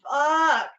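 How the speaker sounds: loud high burst on the punchline; emphatic shout. Real, not acted. Telling the audience how hot the cop was, shouting. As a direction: belt the last word.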